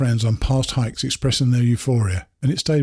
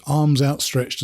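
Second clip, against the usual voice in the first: ordinary delivery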